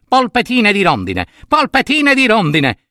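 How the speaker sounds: cantilena acuta da bimbo viziato; reuccio capriccioso. Acted. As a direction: insisti con voce alta, lamentosa. Il reuccio ripete la sua voglia. (capriccio acuto e insistente)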